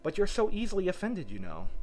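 high-pitched protest; pleading. A narrator's reading. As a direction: plead gently but high. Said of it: "pleaded poor Alice".

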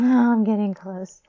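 loud breathless whisper; excited. Acted. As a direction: push it out excitedly. Rising excitement.

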